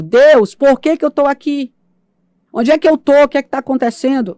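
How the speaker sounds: loud, high, pleading cry; desperate shout to God. Retold or imitated. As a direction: call out to God in anguish. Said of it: "E eu comecei a gritar". (grito desesperado a Deus)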